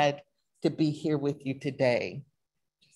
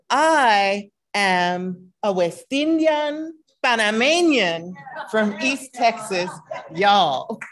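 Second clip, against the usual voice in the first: slow, drawn-out West Indian Panamanian accent, louder, higher; playful West Indian accent; 4 semitones higher, 5 dB louder, slower than usual. Shown as a demonstration. Repeating her self-introduction for laughs in West Indian accent. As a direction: roll it out in a West Indian lilt, ending on y'all. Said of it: "Let me say that again with an accent".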